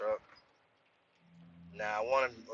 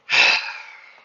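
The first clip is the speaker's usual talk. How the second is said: heavy sigh; 10 dB louder than usual. Real, not acted. Lost the new truck.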